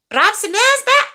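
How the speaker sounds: high-pitched mock Scottish accent. Shown as a demonstration. Streamer showing off the name after the comb-over remark, Scottish accent. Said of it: "that's my Scottish accent".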